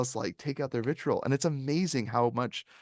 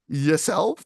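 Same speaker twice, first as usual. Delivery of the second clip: sarcastic retort; 5 semitones higher, 5 dB louder than usual. Retold or imitated. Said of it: "And you're like".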